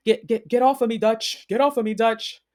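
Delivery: high, loud mock protest; imitated angry yelling. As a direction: act out yelling to be let go. Imitates Tinker fighting off security.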